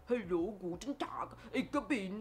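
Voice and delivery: mock Hollywood German accent, whispered; a hint of a fake German accent. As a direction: parody an actor's bad German accent. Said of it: "Hollywood actor who always pretend to be German".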